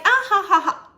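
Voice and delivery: high, loud imitated laugh; mock group laughter. Imitating the others all laughing at her story, mock laughter. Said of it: "they're all laughing".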